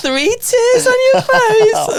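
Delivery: very high, loud, drawn-out mockery; jovial teasing. MC mocking face tattoos, high jovial teasing. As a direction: crow it slowly in mock sympathy. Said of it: "I was in one of my jovial moods".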